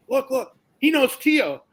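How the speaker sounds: Jose tells Irad that the stranger knows Tio, excitedly; excited calling out. Retold or imitated. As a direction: call your friend over, all worked up.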